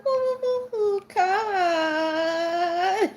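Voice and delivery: high, loud singsong squeal of welcome; excited scream. Real, not acted. Greeting her friend on the live stream, squealing with joy.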